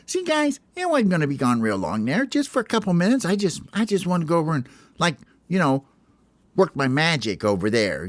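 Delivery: high, boastful bird voice; bragging Hope. Acted. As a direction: show off to the team. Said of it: "He goes".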